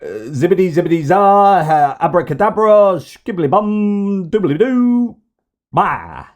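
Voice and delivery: high, silly magician gibberish; hammed-up, squeaky hocus-pocus voice; mocking Trump impression. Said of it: "how are you going to solve it?".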